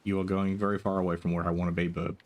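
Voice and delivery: usual speaking voice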